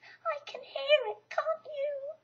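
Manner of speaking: high, slow nervous voice; anxious whisper-like fret. A narrator's reading. Piglet creeping toward the trap with Christopher Robin, anxious. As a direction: ask nervously as you creep closer. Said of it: "said Piglet anxiously".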